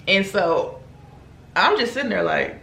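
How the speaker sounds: usual speaking voice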